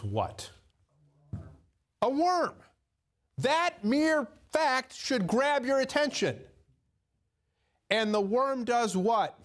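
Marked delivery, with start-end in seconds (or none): 2.0-9.4 emphatic exclaim. Real, not acted. Preacher hammering a point.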